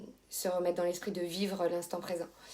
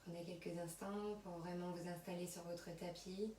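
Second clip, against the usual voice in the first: soft, unhurried yoga nidra voice with long pauses; guided relaxation; 12 dB quieter than usual. Acted. Yoga teacher settling students on their mats, soft guided relaxation. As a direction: guide them quietly through body and breath.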